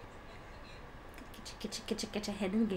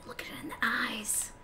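whispered, softer, ominous; mock solemn whisper; 7 dB quieter than usual. Joking to teammates about death, a mock ominous whisper. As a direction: hush it like a spooky secret.

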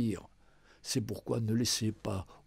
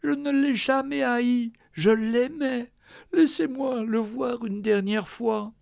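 high, tearful pleading; crying out between sobs; 10 semitones higher, 4 dB louder than usual. Acted. Daughter pleading with the doctor, tearful cry. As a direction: beg to see him once more, choking up. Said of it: "entre deux hoquets, elle cria".

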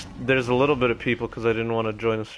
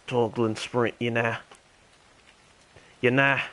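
terrible mock Australian accent; Ringo the Dingo. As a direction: chat along in a badly faked Australian accent. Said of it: "my terrible Australian accent".

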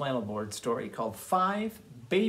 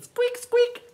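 very high-pitched squeaky-clean squeak; 5 dB louder than usual. Acted. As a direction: squeak like freshly scrubbed hooves. Sound of squeaky clean hooves.

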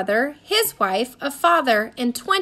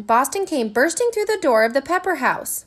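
plain reading voice

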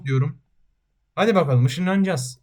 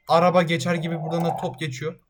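ordinary talk

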